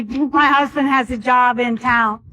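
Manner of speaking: normal talking voice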